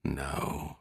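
very soft, low murmur; quiet mutter. A narrator's reading. Captain doubts the sighting to himself, muttering quietly. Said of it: "Stenseth muttered quietly".

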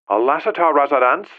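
put-on Cantonese accent, high and light. A narrator's reading. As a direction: answer the phone in a Cantonese accent. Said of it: "a voice with a Cantonese accent answered".

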